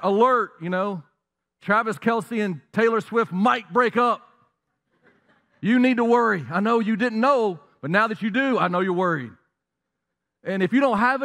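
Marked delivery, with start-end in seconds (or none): 0.0-0.5 louder, higher mock breaking-news call; news alert voice. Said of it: "It's like". 1.6-9.3 higher and louder hammed-up alarmist anchor; mock news anchor. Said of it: "It always says".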